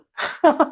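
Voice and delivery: short nervous giggle; an uneasy little laugh. Real, not acted. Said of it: "We both do that nervous laughter thing".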